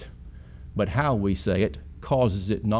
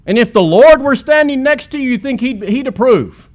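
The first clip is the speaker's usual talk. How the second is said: emphatic challenge; 12 semitones higher, 10 dB louder than usual. Acted. Preacher challenges the congregation.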